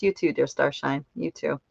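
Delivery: normal talking voice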